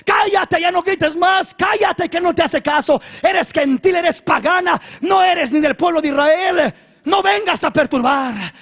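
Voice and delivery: high, loud rebuke; hammering, high-pitched hushing; shouted scolding. Acted. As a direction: shout her down insistently. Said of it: "Le gritaba a los discípulos".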